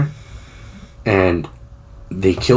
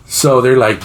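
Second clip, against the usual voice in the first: ordinary talk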